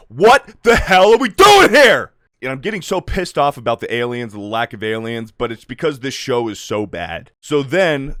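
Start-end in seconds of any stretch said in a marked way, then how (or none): 0.1-2.1 loud, high, drawn-out outrage; ranting fan at full volume; exasperated yell. Real, not acted. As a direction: yell it slowly in sheer disbelief. Ranting, pissed off about missing aliens.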